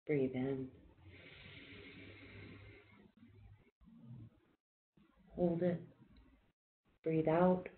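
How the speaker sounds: soft, calm breathing cues with long pauses; quiet meditation guide counting breaths; guided breathing. Acted. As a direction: ease the group through each breath, gently. Leads a guided breathing exercise.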